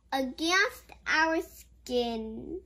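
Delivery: ordinary delivery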